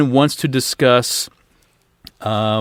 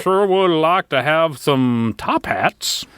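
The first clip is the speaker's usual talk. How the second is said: posh crab voice; 4 semitones higher than usual. Acted. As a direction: play a snooty crab. Voicing a fancy crab.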